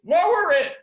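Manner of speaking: high, loud two-word order; loud command. Retold or imitated. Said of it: "And I'd say".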